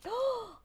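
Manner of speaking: sudden high gasp of shock; sharp gasp. Real, not acted. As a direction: gasp in surprise. Spotting a missing ink.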